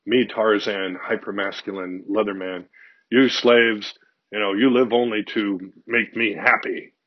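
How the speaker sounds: loud chest-thumping he-man bluster; mock macho guy. A blustering man lording it over his slaves, loud macho. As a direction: bellow it like a swaggering caveman. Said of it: "some guy would be doing that".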